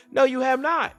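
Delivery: ordinary talk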